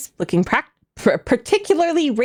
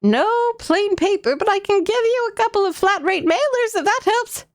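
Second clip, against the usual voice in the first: grandma voice; 7 semitones higher than usual. Acted. Player voicing grandma clerk.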